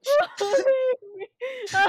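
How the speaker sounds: squealing laugh; shrieking laugh; high laughing squeal. Real, not acted. Laughing cry at the bit.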